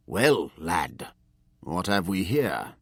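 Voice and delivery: slow, cold mentor's challenge; stern old master. A narrator's reading. Master catches apprentice at the desk, slow and stern. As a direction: catch the boy in the act, measured.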